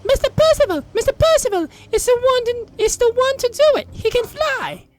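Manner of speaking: put-on very high boyish call to the pelican; great shout. A narrator's reading. Storm Boy calling his pelican to the rescue, high shout. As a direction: cry out in a high child's voice. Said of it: "Storm Boy gave the great shout".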